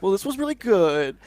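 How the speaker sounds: hammed-up encouragement, sweetening the bad news; compliment-sandwich boss. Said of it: "it's like a compliment sandwich".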